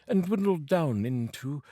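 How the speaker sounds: plain reading voice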